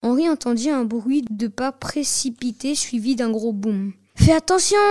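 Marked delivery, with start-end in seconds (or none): 4.1-4.8 higher and louder, a quick warning cry; neighbour's wife snapping a warning at her husband; sharp warning. A narrator's reading. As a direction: call it out to stop him dropping it. Wife warns husband loading car.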